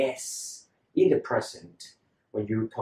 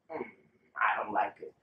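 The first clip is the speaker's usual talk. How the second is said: ordinary talk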